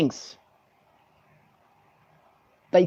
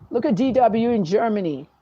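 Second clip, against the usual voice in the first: usual speaking voice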